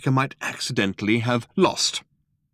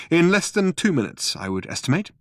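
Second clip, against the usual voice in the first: flippant; 7 semitones higher than usual. Acted. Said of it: "He was at his flippest".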